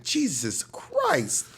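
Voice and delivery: slow, high-pitched drawn-out exclamation; retold shock. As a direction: stretch it out in stunned disbelief. Retold shock at near accident.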